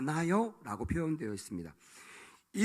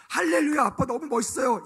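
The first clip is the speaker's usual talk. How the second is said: high, sing-song cheer; mock delight; 8 semitones higher, 6 dB louder than usual. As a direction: gush like a thrilled child. Children cheering rich father's gift.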